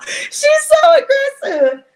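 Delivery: high, slow squeal. Real, not acted. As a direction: squeal it out in disbelief.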